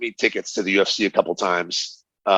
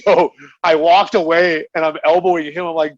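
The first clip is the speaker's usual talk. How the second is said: laughing while telling; 9 semitones higher, 3 dB louder than usual. Real, not acted. Laughing as he finishes story.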